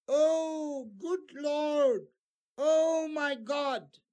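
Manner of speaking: high, loud cry of shock; shocked exclamation. Shown as a demonstration. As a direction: gasp it out in disbelief. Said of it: "this is an exclamation".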